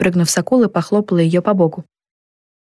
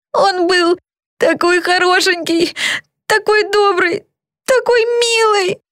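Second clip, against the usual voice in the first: slow, high-pitched weepy grieving; tearful lament; 13 semitones higher, slower than usual. A narrator's reading. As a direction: mourn him through tears, high and drawn out. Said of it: "проговорила Зельда сквозь слёзы". (сквозь слёзы, высоким голосом, протяжно)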